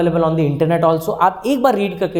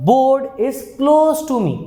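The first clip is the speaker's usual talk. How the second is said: slow, drawn-out model sentence; slow dictation; 6 semitones higher, much slower than usual. Shown as a demonstration. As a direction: spell it out slowly for the class. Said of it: "अब मैं बोलता हूँ".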